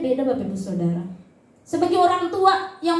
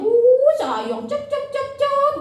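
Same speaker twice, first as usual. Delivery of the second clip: put-on hushing baby; 9 semitones higher, much slower than usual. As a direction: lull a baby to sleep.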